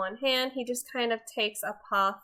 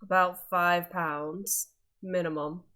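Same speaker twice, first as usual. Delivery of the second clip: gruff cook voice; 3 semitones lower than usual. Acted. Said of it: "in a very gruff voice".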